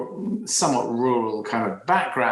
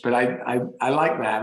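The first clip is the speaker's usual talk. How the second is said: ordinary talk, nothing marked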